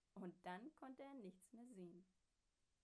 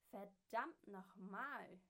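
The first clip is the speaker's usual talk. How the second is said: slow, much louder furious yell; angry shout; 9 dB louder, slower than usual. Acted. Old man yelling at the frog who painted his head. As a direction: roar it out in outrage. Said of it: "schrie der alte Mann".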